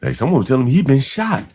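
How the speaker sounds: ordinary talk, nothing marked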